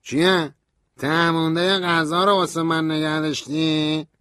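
high, slowish scornful voice; Stanley sneering. A narrator's reading. Stanley scorns the food offer, high sneering voice.

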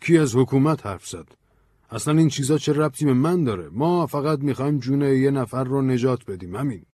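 exasperated, pitch climbing. A narrator's reading. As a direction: protest as if fed up with being misread. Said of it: "بن با کلافگی گفت".

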